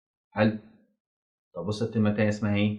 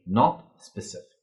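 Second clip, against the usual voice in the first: slow teacher emphasis; 2 semitones lower, much slower than usual. Shown as a demonstration. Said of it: "بتخليه الحاجة اسمها إيه".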